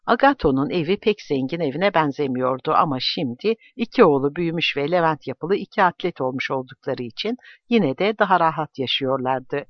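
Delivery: plain reading voice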